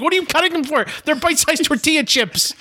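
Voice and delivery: high-pitched incredulous complaint; worked-up mock outrage; exasperated protest. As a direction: protest the absurdity, voice way up. Acted exasperated protest to friend.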